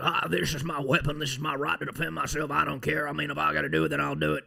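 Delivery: ranting radio host on a roll; put-on conspiracy-host voice; Alex Jones. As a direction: run it out in a strained, unbroken rant. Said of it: "start sounding like Alex Jones".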